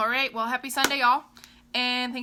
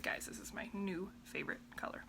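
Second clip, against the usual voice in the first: whispering; 2 semitones lower, 11 dB quieter than usual. Retold or imitated. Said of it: "I was like".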